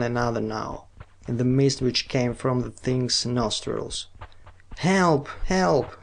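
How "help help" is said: high desperate cry for help; screaming for help. A narrator's reading. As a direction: scream it out, despairing. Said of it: "screamed the girl".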